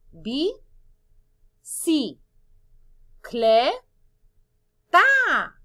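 four isolated beats, "ta" loud and high at the end; separate syllables. Shown as a demonstration. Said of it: "¿Dónde está la combinación consonántica?". ("Bi, ci, cle, ta" muy separado, cada vez más fuerte)

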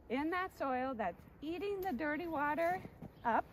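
usual speaking voice

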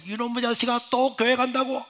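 high incredulous scolding; angry mother scolding. Retold or imitated. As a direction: scold him for going again. Mother yells at son. (기가 막혀 꾸짖는 어머니)

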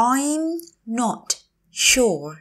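I'm, not, sure, each said separately; slow teacher model of "I'm not sure"; word by word. Shown as a demonstration. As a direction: say "I'm not sure" slowly, pausing between words. Teacher repeats phrase word by word.

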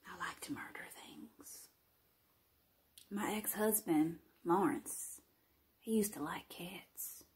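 hushed, creepy murderer's whisper; sinister whisper. Acted. Confessing to camera about killing the cat, in a sinister whisper. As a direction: breathe it out like a deadly secret.

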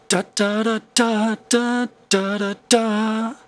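scat the rhythm, leaning on the fourth da; vocal rhythm with a punch on the fourth beat; stressed fourth syllable. Shown as a demonstration. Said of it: "There's an emphasis on, on that fourth syllable".

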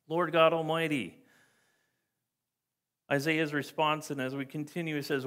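ordinary delivery

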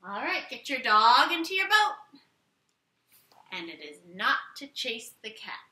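usual speaking voice

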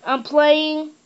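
yelling up the stairs; calling out. Real, not acted. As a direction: call out loudly to grandpa. Yelling to grandpa downstairs.

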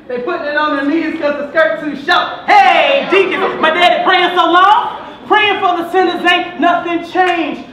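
building church call-out. Acted. Poet calling to the deacon in church, building call-out. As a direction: call out to the congregation, stretching the last words.

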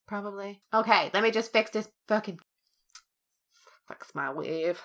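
ordinary delivery

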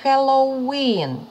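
slow, high Halloween with a drawn-out WEEN; strong final stress. Shown as a demonstration. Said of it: "сильний наголос".

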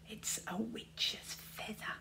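slow, hushed whisper. A narrator's reading. Tsar whispering to Luther about the feather, hushed. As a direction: breathe it like a secret. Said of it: "whispered Tsar".

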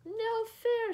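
high, loud protest; shouted. A narrator's reading. Monkeys protesting to Lulu, shouting high. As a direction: yell it like caught kids. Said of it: "shouted the monkeys".

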